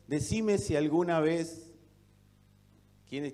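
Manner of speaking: normal talking voice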